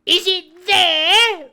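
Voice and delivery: high, very loud, slow demand; roaring. Acted. Blind beggar demanding news of the treasure, roaring. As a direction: roar the question up at the window. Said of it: "roared Pew".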